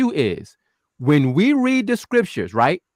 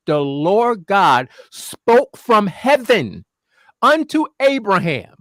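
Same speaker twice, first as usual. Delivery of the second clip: very slow, solemn recitation of the verse; deliberate, slowed-down quoting of scripture; slow scripture reading; much slower than usual. Shown as a demonstration. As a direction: draw out "unto Abraham" as if reading from the pulpit. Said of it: "What about this one?".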